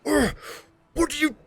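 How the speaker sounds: high, loud, strained monster voice; a big bugbear sneering down at a puny pusher; bugbear growl. Acted. Bugbear mocking the hero who tries to shove him, loud and high. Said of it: "looks down to you and goes".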